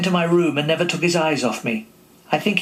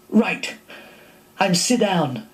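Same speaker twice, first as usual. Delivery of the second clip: flustered; 5 semitones higher, slower than usual. Retold or imitated. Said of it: "I said".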